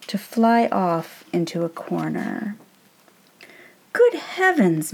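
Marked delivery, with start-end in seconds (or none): 3.9-4.9 high startled cry; shrieked. Acted. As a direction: yelp in fright at the sudden apparition. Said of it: "Mister Taylor shrieked".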